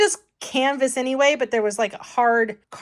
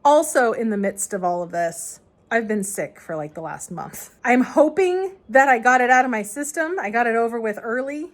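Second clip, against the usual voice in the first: usual speaking voice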